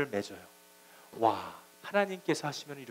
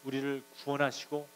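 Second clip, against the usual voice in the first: ordinary delivery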